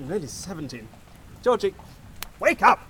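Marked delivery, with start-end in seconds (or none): 1.4-2.8 loud call to rouse a sleeper. Acted. Boss calls Georgie awake for the funeral, loud call. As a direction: raise your voice to wake him.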